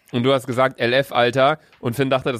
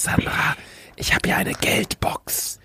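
slow unvoiced whisper; ASMR whisper; 4 dB quieter than usual. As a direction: breathe every word softly. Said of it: "ASMR, ganz ruhig". (ASMR-Flüstern)

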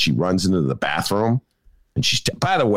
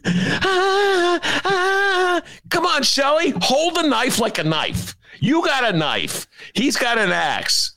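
high, slow mock-scolding shriek; hammed-up horror heroine voice; Shelley Duvall impression; much slower than usual. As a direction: screech at the heroine to toughen up. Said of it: "great impression of Shelley Duvall".

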